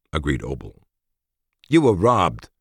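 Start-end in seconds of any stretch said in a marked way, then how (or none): 1.7-2.5 insistent. A narrator's reading. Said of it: "insisted Yin".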